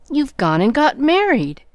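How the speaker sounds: high loud accusing cry; caught-you exclamation. Acted. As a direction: pounce on the news. Jo pounces on the secret.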